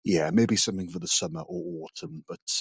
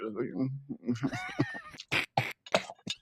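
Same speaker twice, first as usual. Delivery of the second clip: campy cackle; 6 semitones higher, 8 dB quieter than usual. Acted. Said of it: "became Lucille Ball at the end".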